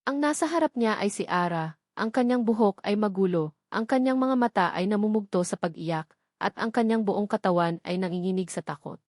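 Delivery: ordinary delivery, nothing marked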